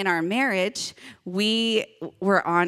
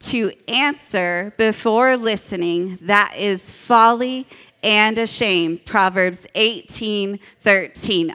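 slow, loud verse recitation; group recitation; 6 dB louder, slower than usual. Shown as a demonstration. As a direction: lead the room word by word, slowly and loudly. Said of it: "say the verse together".

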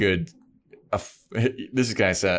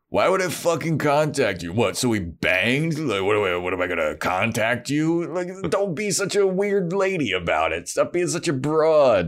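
raised, exaggerated scoffing retelling; mocking jerk; 8 semitones higher than usual. Angel brushing off Buffy after their night together, mock-scornful. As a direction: play the dismissive jerk, hammed up.

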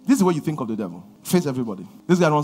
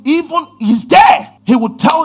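shout; 8 semitones higher, 5 dB louder, slower than usual. Real, not acted. Preacher shouting warning to congregation.

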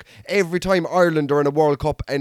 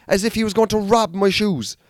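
ordinary delivery

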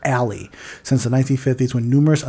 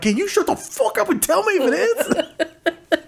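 laughing exasperation. Retold or imitated. Frustrated retort, laughing.

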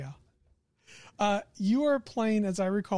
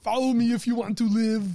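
put-on action-hero accent; hammed-up heroic order; Arnold character voice; 4 dB louder than usual. Game master quoting a rescuer's order, Arnold impression. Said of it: "in that accent for that voice".

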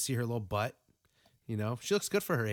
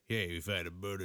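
mock detective; 5 semitones lower, 3 dB quieter than usual. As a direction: announce it like a self-important cop. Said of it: "he's like".

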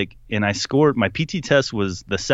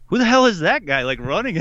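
high-pitched mock disbelief; acted-out "who on earth" astonishment; incredulous; 8 semitones higher than usual. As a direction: retell it baffled, voice jumping up. Retold disbelief at the runner.

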